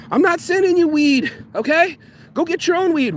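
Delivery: high-pitched, fed-up brush-off; exasperated refusal. Acted. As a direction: push it way up high, done with being pestered. Acted refusal to pestering follower.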